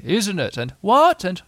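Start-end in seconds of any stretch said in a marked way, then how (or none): 0.0-0.6 mild storybook shout, pitched up. A narrator's reading. Friends holding on in wind, mild high shout. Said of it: "holding on to each other and shouting". 0.8-1.2 high, loud storybook shout. A narrator's reading. Friends in high wind, high loud shout. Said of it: "holding on to each other and shouting".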